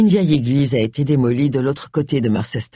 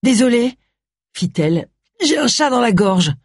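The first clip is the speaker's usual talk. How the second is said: choked voice; 7 semitones higher than usual. A narrator's reading. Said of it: "sa voix s'étrangla".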